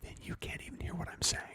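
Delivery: barely audible whisper; speaking really quietly. Real, not acted. Testing cohost's hearing, speaking really quietly in a whisper. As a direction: whisper very softly into the mic. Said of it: "if I speak really quietly".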